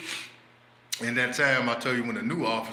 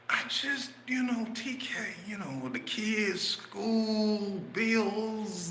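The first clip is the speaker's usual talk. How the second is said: whiny high voice; 10 semitones higher, much slower than usual. Retold or imitated. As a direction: list the troubles like a sad complainer. Friend whines about troubles, high.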